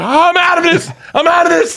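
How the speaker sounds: very high mock alarm; panicked refusal. Imitating a friend about to break up, alarmed refusal. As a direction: bail out in a high, hammed-up voice.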